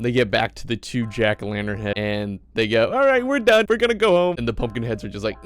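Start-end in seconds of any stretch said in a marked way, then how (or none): 2.9-4.4 put-on high, loud kid voice; kids calling it quits. Kids with full bags telling pumpkins, done. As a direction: announce it in a raised mock kid voice. Tired kids announcing they quit.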